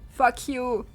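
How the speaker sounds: usual speaking voice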